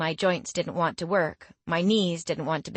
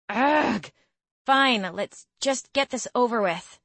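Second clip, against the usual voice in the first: reluctant, pitched-up sigh of defeat; grudging groan; 6 semitones higher than usual. Acted. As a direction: groan and give in. Grudging agreement to crunches.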